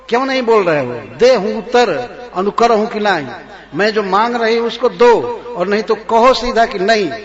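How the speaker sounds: corner the king with his own vow; mocking angry queen. Kaikeyi mocking Dasharatha's vow. (राजा को ताना मारती क्रोधित रानी)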